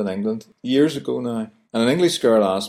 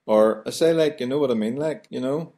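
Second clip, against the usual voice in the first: higher, rambling Belfast chat; chatty Belfast talk; 4 semitones higher than usual. Shown as a demonstration. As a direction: natter on Belfast style. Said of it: "For example".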